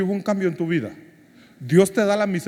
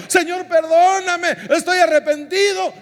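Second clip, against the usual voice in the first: clamoring plea; 12 semitones higher, 4 dB louder than usual. Pretend. Preacher mimicking a kneeling believer crying out, loud and high. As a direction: wail it out, half performed repentance. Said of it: "llorando, clamando".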